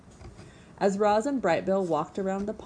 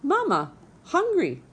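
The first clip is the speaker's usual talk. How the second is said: put-on much higher, loud baby gosling cry; peeping gosling; 11 semitones higher, 5 dB louder than usual. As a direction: squeak it like a hungry chick. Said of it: "Brightbill started peeping".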